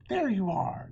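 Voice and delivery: calling out loud and high; mother calling out in relief; cried out. Acted. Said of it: "Lady Di cried".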